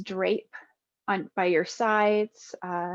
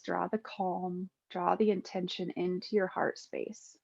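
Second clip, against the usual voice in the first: guided meditation; 4 dB quieter than usual. Acted. Yoga teacher guiding listeners lying with eyes closed, calm meditation voice. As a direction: ease them into stillness, phrase by unhurried phrase.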